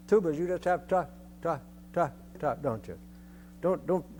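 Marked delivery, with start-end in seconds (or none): 0.9-2.5 evenly spaced TAs, tailing off softer; no accents. Shown as a demonstration. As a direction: mark each TA without any stress. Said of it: "no accents at all".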